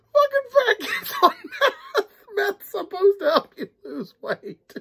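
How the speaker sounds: slow, high, laughing quote; laughing mock author quote. Imagining the author's reaction, slow laughing quote. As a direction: quote the author while giggling. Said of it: "He's gonna be like".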